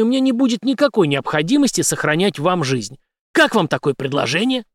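high, forceful ultimatum; commanding interrogator. A narrator's reading. Henry giving the captured major his ultimatum, commanding. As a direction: lay out terms loudly and firmly.

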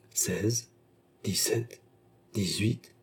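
hushed, soft count to oneself; breathless-with-joy counting. A narrator's reading. Beausire gathers the gold coins, counting in hushed joy. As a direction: count softly, absorbed in the treasure. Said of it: "disait Beausire, pantelant de joie".

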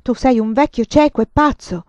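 loud, high accusing outburst; angry. A narrator's reading. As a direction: jab the words at him. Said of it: "agitò il dito accusatorio".

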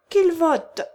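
loud, high rallying cry; many voices shouting together; crowd shout. Acted. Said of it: "cria-t-on comme pour le précédent".

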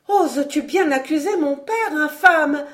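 high, loud indignation; young lady defending her father, pitch soaring; outraged accusation. A narrator's reading. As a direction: challenge him in horrified outrage.

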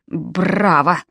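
low, soft, teeth chattering with cold. A narrator's reading. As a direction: stammer it through chattering teeth. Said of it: "клацая зубами".

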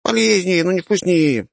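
put-on very high, cocky kid comeback; cheeky retort. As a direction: throw the word back at her. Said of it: "парировал Дамир".

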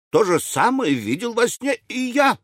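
high-pitched eager exclamation; восклицание. A narrator's reading. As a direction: burst in excitedly, voice jumping up. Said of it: "воскликнул Браун". (взволнованное восклицание высоким голосом)

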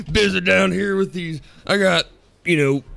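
high, put-on Southern voice; Ziggy. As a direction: do a bad, high-pitched Southern impression. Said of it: "can't even do a fucking Ziggy impression".

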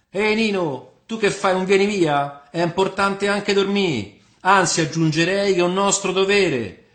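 high, loud Roman man of the people; tipsy tavern philosopher. Acted. Ciceruacchio urges Nino to go sleep, tipsy and declaiming. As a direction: hold forth grandly to the whole tavern.

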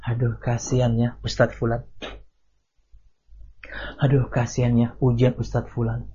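soft, sighing fake sympathy; mock pity. Preacher imitating a gossip, soft fake sympathy. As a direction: pity that carries a hidden jab, murmured. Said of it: "hingga mengatakan".

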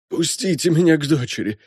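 high, desperate father's plea; imploring colonel; pleading. A narrator's reading. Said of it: "молит Фогдахи".

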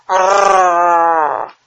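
long rolling shouted growl; giant growl. A narrator's reading. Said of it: "y gruñía".

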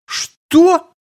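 sudden high shocked cry; shocked yelp. A narrator's reading. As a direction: jump up in outrage. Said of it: "взвилась сестра".

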